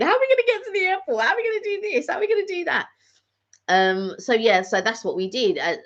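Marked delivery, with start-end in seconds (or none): 0.0-2.9 much higher, fast, frantic self-talk; high-pitched, racing internal panic; panicky inner voice. Retold or imitated. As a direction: squeak out the worry in a rush. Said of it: "But I was panicking".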